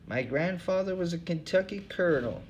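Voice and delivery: a weary bum who cares for nothing, high; second hobo voice. Acted. Bum Hubbard answering the interviewer, in a high tired voice.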